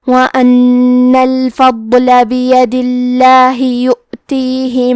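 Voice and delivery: slow, melodic tajweed chant; measured Arabic tilawat, each phrase drawn out; Quran recitation. Acted. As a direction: recite the verses in a long, sung Quranic cadence. Teacher recites Quran verses.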